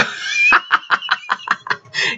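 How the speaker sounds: real giggle breaking out mid-sentence; helpless giggling fit; burst of laughter. Real, not acted. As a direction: crack up laughing. Laughs reading the message.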